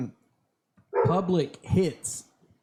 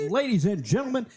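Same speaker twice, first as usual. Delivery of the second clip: higher booming arena announcer; 6 semitones higher than usual. Pretend. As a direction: announce it like a fight is starting. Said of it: "I don't know why I have the announcer voice".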